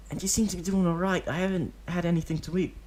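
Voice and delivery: put-on high boyish whine; a hungry kid laying it on; pleading child. Deidara telling Obito he's hungry, high pleading child voice.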